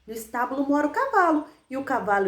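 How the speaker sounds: usual speaking voice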